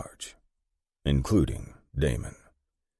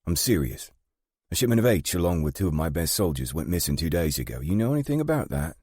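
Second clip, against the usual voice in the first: higher, businesslike woman boss, pressing; British gang boss; 5 semitones higher, faster than usual. Acted. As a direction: press him quickly for answers, voice rising into the question.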